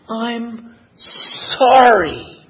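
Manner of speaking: a very slow, tender "I'm sorry"; the mother apologising to her hurt child with all her love; heartfelt apology. Retold or imitated. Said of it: "with all the empathy and all the love".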